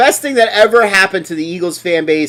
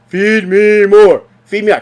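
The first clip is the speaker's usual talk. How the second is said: mocking greedy player; 3 semitones higher, 4 dB louder than usual. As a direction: drag out each word like a spoiled star. Mocking the running back.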